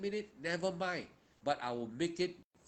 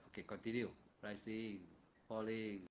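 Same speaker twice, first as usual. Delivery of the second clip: guided meditation; 4 semitones lower, 7 dB quieter than usual. Acted. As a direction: ease them into the breath quietly. Guiding meditation noting.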